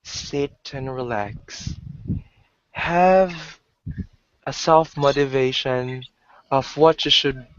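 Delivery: slow, halting relaxation-track voice; imitating meditation audio. Describing background audio on frequency videos, imitating meditation audio. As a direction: imitate a guided track, leaving long gaps. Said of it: "like, a background audio".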